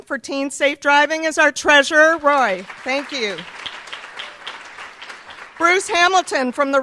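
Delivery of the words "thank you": ordinary talk, nothing marked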